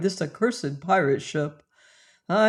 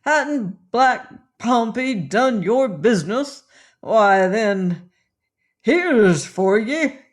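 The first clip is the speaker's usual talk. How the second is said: put-on loud, higher drunken bellow, slowing at the end; drunken roar; 4 semitones higher, 5 dB louder, slower than usual. A narrator's reading. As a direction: bellow the threat like a drunk pirate. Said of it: "roared he in a drunken frenzy".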